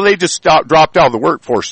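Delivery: ordinary delivery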